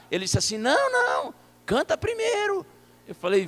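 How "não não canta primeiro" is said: put-on very high-pitched old man's protest; insistent old man. Old pastor demanding a song before prayer, insistent old man.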